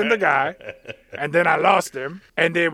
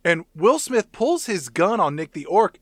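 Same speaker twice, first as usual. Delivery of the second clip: ordinary talk, nothing marked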